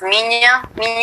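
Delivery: gentle Portuguese "Minha". Shown as a demonstration. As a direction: say "Minha" slowly, nasal and subtle. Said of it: "It's spelled M I N H A".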